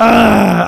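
loud, drawn-out groan; anguished groan. Real, not acted. Moved fan hearing about Paul Walker's car, groaning. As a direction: groan as if it hurts to hear.

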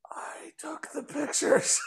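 small breathless whisper, high; sheepish whispered confession. Retold or imitated. Wife admitting she took the photos, whispered high. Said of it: "And she just goes".

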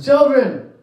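high, carrying call from the shore; a lightly voiced Jesus calling from the shore; calling out across the water. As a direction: sing it out to the boat like a friendly shout. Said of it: "he calls out to them".

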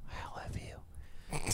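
breathy whispered "I love you"; mock tender whisper to listeners. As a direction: whisper it like a secret.

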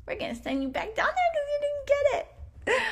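lightly hammed-up angel; mock angel voice. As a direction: play a chirpy angel sending you back. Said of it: "they're like".

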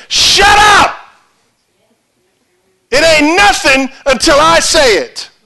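high, thunderous shouted rebuke; God. Acted. God silencing arguing believers, shouted rebuke. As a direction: shout them all down with final authority. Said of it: "I can hear him saying".

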